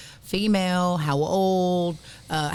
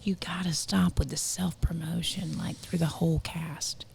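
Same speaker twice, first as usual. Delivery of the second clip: hushed confiding whisper; 6 dB quieter than usual. Real, not acted. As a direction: drop to a whisper to share a secret. Confiding criticism of a guest.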